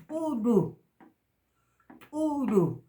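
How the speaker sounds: "uru" said high with a glottal catch; glottal uru model. Shown as a demonstration. Teacher modelling the glottalized word uru. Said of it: "afectado por el signo glotalizado".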